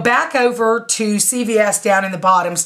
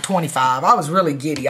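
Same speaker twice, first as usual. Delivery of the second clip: usual speaking voice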